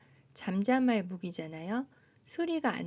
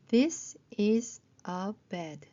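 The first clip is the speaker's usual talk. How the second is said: very slow, each word separate; slow model reading; much slower than usual. Shown as a demonstration. Teacher checking phonics with short sentences, slow model reading. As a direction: read it out slowly for beginners.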